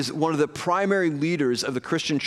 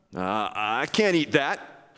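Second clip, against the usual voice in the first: slow, lower, hesitant acted reply; awkward refusal; 4 semitones lower, much slower than usual. Peter turns down his Gentile friend's pork, slow awkward refusal. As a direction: drag out a reluctant excuse.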